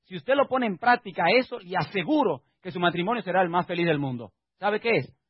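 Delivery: ordinary delivery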